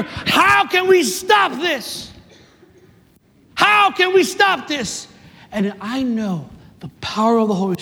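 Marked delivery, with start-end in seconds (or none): 0.0-5.1 high, drawn-out plea; preacher voicing a city's alarm; acted outcry. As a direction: cry it out slowly as a desperate public question. Voices the city's worried complaint.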